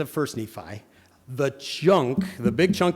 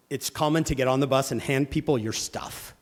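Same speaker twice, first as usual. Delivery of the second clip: normal talking voice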